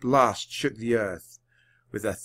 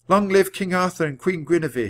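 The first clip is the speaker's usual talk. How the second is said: higher-pitched narrated cheer; mild rallying shout; 6 semitones higher than usual. A narrator's reading. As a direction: proclaim it like a toast, without full volume. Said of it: "Jack and Annie shouted their words".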